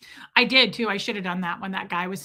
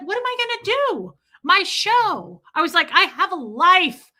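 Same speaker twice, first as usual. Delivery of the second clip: high, loud dramatic wail; retold whining; 8 semitones higher, 5 dB louder than usual. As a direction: whine like a put-upon diva. Acted whining at parents.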